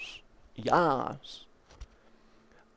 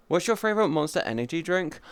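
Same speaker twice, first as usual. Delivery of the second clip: normal talking voice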